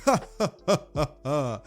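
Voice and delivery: high booming hero laugh; hearty laugh. Retold or imitated. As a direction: laugh big while slapping his back. All Might slaps Bakugo's back.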